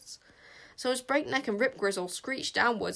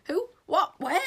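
mumbling; 8 semitones higher, 4 dB louder than usual. A narrator's reading.

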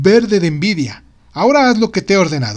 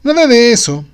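emphatic; 7 semitones higher, 6 dB louder than usual. Acted. Inventor's emphatic denial.

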